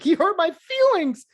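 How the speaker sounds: high, whiny mocking voice; mock a player whining about hurt feelings.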